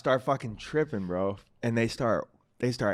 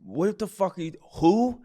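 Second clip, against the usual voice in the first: higher-pitched baffled question, voice rising on "who"; confused; 4 semitones higher than usual. Retold or imitated. Bartender answers a stranger's phone call, mock confused. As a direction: retell a bartender's bewilderment. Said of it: "like, all confused".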